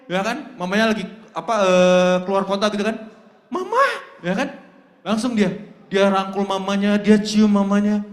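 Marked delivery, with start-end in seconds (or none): 3.5-3.8 put-on child calling mama happily. Child greeting returning mother.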